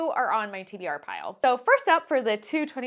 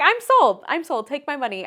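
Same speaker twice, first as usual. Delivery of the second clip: loud, high burst of eagerness; higher, louder eager exclamation that fades; excited exclaim; 4 semitones higher, 6 dB louder than usual. Retold or imitated. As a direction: blurt it out like an instant buy. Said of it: "And then I was like".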